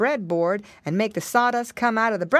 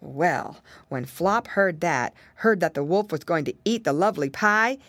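ordinary delivery

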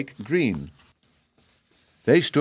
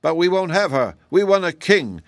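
high, forceful protest; exclaiming; 8 semitones higher, 4 dB louder than usual. A narrator's reading. Jack Rose rejects the princess as ruler, exclaiming high. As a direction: exclaim it in high-pitched refusal. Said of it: "exclaimed a Jack Rose".

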